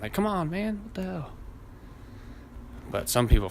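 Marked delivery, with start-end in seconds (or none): 0.1-1.3 high-pitched driver's complaint; mock exasperation. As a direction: imitate griping at the car ahead. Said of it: "like, a honk and".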